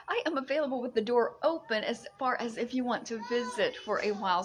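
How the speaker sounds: usual speaking voice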